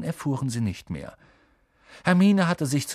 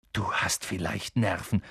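low, weary groan of disbelief; 4 semitones lower, 4 dB quieter than usual. A narrator's reading. Ron reacts to Hermine's cheek, groaning. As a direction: groan it out, pitched down. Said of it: "stöhnte Ron".

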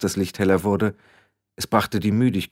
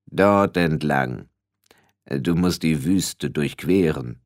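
low, very slow and weighty; slow, ponderous voice of Death; heavy-voiced Death; 5 semitones lower, slower than usual. A narrator's reading. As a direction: point the way in a deep, drawn-out voice. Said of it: "Die Stimme war schwer".

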